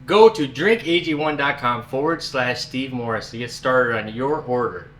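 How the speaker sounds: usual speaking voice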